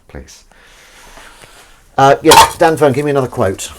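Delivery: ordinary talk, nothing marked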